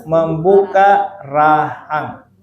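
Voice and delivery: "Membuka rahang" drawn out slowly, word by word; slow explanation. Shown as a demonstration. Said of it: "Apa fathul fakki". ("Membuka rahang" diucapkan pelan)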